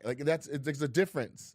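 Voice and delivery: normal talking voice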